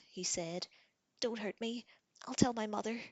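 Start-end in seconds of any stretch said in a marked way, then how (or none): 1.2-3.0 high, frightened plea; scared pleading. Acted. Wounded prince begging the girl, scared. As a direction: beg not to be hurt.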